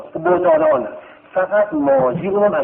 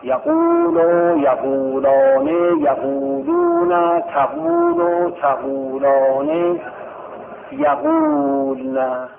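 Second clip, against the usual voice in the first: slow conjugation drill; 8 semitones higher, much slower than usual. Shown as a demonstration. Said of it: "یقولو صاف کنید ببینم".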